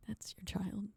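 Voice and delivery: very soft, under the breath; hushed disbelief. Acted. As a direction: say it quietly, as if stating the obvious. Acted disbelief at a jealous parent.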